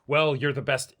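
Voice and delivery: ordinary talk, nothing marked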